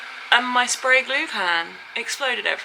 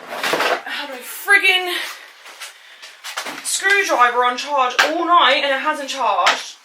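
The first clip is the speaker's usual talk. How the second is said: loud, higher-pitched frustrated venting; exasperated; 4 semitones higher, 6 dB louder than usual. Real, not acted. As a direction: vent it loudly, at the end of your patience. Giving up on a failing build.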